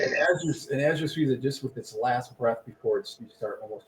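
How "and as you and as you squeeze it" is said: usual speaking voice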